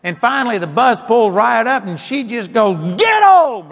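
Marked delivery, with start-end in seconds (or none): 3.0-3.7 very high, very loud shouted command; sudden yell. Retold or imitated. Elderly lady losing patience with her dog, sudden yell. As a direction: explode at the dog. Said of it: "like that".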